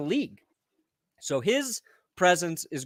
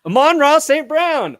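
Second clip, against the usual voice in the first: loud, higher, insisting on the name; insistent correction; 9 semitones higher, 9 dB louder than usual. Real, not acted. Arguing with his co-host over the name, loud insistence. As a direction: set the record straight, loudly. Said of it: "He said Amon".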